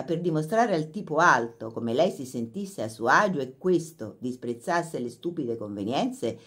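usual speaking voice

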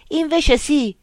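shouting. Acted. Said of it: "gridò Remo".

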